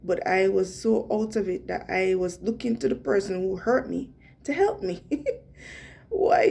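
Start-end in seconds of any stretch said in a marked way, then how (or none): 5.1-6.5 high burst of real laughter; laughing. Real, not acted. Laughing at herself while telling the story. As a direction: laugh it out in disbelief.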